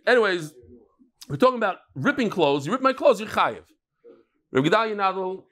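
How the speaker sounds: ordinary talk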